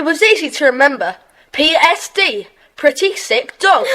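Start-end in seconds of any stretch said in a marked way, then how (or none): 2.8-4.0 laughing at own joke. Acted. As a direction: chuckle through the punchline, then laugh.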